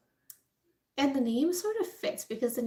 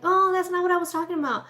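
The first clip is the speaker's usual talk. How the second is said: quick, higher mock-innocent dodge; feigned innocence; 6 semitones higher, faster than usual. Imagined Nixon dodging blame with a hasty, higher innocent excuse. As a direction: play dumb fast, like you've been caught. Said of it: "you could be like".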